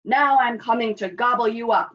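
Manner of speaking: loud troll threat; troll's roar. A narrator's reading. Troll threatening the big goat, loud roar. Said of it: "roared the troll".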